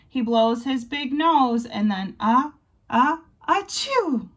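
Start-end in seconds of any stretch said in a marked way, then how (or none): 2.2-4.3 building sneeze ending in a high achoo; big sneeze. Acted. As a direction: wind up and sneeze.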